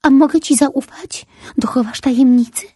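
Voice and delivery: put-on a small child begging for trust; little boy voice. A narrator's reading. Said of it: "spytał". (malec prosi wysokim, głośniejszym głosem)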